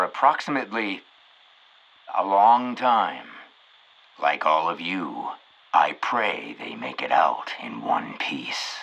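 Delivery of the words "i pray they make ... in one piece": lower, hushed mock prayer; mock-solemn whispered prayer.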